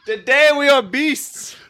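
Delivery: half-laughing battle rally; rousing movie speech. Retold or imitated. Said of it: "I know this whole speech".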